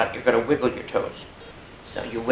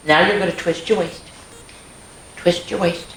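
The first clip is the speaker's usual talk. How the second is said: ordinary delivery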